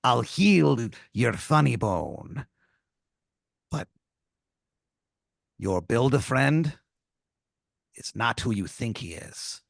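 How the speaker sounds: slow, secretive pirate voice; lightly voiced pirate warning; pirate tomato. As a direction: reveal a betrayal, hushed. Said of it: "He goes".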